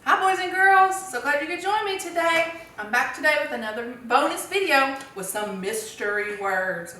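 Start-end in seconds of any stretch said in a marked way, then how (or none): 0.1-2.5 put-on high, bright kid-show hello; cheery greeting to kids. Teacher greets the boys and girls, sing-song. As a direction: welcome the children like a TV teacher.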